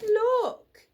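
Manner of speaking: put-on girl crying out at the screen; cried. A narrator's reading. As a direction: point and shout. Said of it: "Lucy cried".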